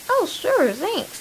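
squeaky high, mock-cheerful thanks; mock grateful reply. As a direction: play it up like a kid pretending to be delighted. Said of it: "I'm like".

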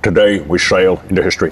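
slushy Scottish Sean Connery lisp; Sean Connery impression. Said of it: "I'm doing it like Sean Connery".

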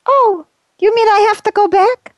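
high, far-off wailing ghost voice, forlorn; ghost of Saint Valentine. Acted. Saint Valentine's ghost answering the host, in a high wailing voice. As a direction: wail it like a lost saint calling from afar.

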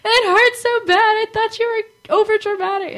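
sick mom admitting daughter wasn't dramatic, high whine; mock whiny mom. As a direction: whine it like a mom who finally gets it. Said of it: "and she's like".

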